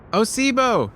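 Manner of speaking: high, bright call down a pit; cheerful call. A narrator's reading. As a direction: sing out his name cheerfully. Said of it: "he calls cheerfully".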